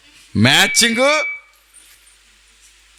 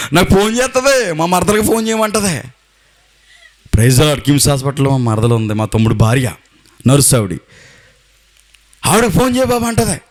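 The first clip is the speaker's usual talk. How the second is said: ordinary delivery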